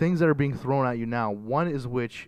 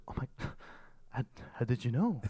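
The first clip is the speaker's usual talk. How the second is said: badly faked Croatian accent, softer at the end; attempted Croatian accent; 10 dB quieter than usual. Greeter surprised to be recognised as Croatian, attempted Croatian accent. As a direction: have a rough go at a Croatian accent. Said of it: "that's not even a Croatian accent".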